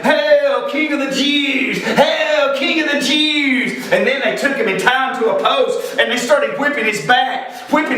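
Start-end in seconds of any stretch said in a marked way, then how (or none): 0.0-3.9 high, mock-reverent jeering hail. Soldiers mocking Jesus as they beat him, high jeering cry. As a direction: hail him in mockery, pitched well up. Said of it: "started mocking him and saying".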